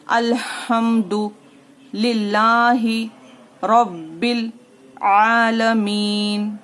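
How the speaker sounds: slow recited chant, आलमीन drawn out; Quranic recitation. Shown as a demonstration. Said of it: "अब यहाँ पर देखिए".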